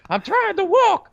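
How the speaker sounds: very high put-on baby voice, insistent; voicing his baby daughter. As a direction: squeak it out like a determined baby. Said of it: "what she's saying is".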